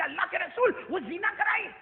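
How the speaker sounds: high, indignant shouting; heated full-voice denunciation; angry report. Retold or imitated. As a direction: recount the sin in outrage, building to a yell. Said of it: "ابو ہریرہ غصے میں کہنے لگے".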